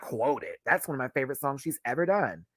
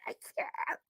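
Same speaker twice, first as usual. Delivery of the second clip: whispered I can't; 12 dB quieter than usual. Real, not acted. Whispered after laughing.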